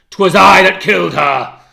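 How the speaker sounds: loud raging outburst; furious shout. Acted. Husband shouts his guilt at the maid, enraged. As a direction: hurl the confession at her.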